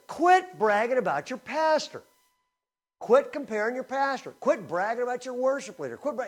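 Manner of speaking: high-pitched, insistent rebuke, starting loud; scolding exhortation. Acted. Preacher as Paul telling the church to stop bragging, scolding.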